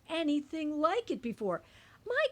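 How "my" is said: excited shout. A narrator's reading. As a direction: gasp out in delight. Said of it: "they shouted".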